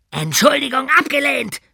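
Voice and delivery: high, loud bellow. Acted. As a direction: roar the refusal. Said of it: "brüllte ihn Kniescheibe an".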